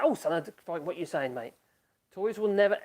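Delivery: ordinary talk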